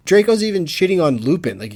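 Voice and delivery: ordinary delivery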